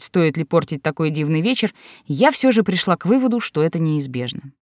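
ordinary delivery, nothing marked

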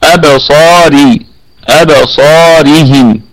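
slow أبصارهم with a light, thin ر; tajweed demo. Shown as a demonstration. Teacher models the thin ر in أبصارهم for students. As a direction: draw out أبصارهم and keep the ر thin. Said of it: "والراء مرققة لأنها مكسورة".

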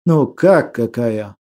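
slow, low-pitched surprised question. A narrator's reading. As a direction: draw the question out in mild surprise. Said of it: "удивился Алфрам".

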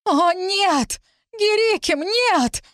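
put-on high-pitched girl's horrified shriek. A narrator's reading. Girl sees the beaten man, shrieking. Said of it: "взвизгнула какая-то девушка".

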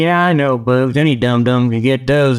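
normal talking voice

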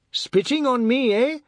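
high, loud taunt; shrill-edged challenge; indignant boy. A narrator's reading. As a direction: throw it at him, affronted. Boy taunts the man, aggrieved.

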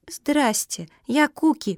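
high, squeaky small voice; little squeak. A narrator's reading. Little pug introducing herself to the turtle, squeaky. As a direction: pipe up lightly. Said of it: "пропищала мопсиха".

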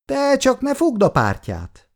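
high, combative scolding. A narrator's reading. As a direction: snap it back like a fighting mother. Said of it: "mondta harciasan".

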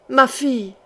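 mild, acted cry of anguish; understated mother's howl. A narrator's reading. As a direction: cry out for your child, restrained. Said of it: "et ce hurlement".

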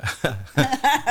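a short high laugh bursting out; amused chuckle before the punchline; burst of laughter. Real, not acted. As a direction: laugh at your own joke. Joking about quiz idea.

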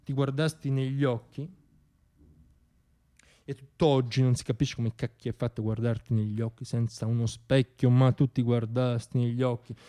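usual speaking voice

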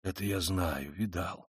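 low, very soft official's mumble; muttering. A narrator's reading. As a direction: mutter it under your breath. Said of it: "бормотал чиновник". (тихо бормотать)

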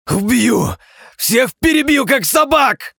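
high, loud rage roar; furious shout. A narrator's reading. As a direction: scream the threat in fury. Crown prince raging, threatening.

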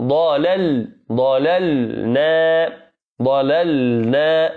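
"ضللنا" very slow, each ل distinct; clear double lam. Shown as a demonstration. Teacher drilling the correct form of ضللنا. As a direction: say "ضللنا" slowly, both lams apart. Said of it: "تقول".

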